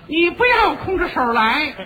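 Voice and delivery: high, loud mynah-bird voice; parrot voice. Acted. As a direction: squawk it like a talking bird. Talking bird voiced in crosstalk. (尖声学鸟说话)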